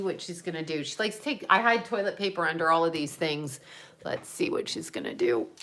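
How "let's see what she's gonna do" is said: hushed whisper so the cat stays asleep. Real, not acted. Sneaking up on her sleeping cat, whispering. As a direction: breathe it out without voice.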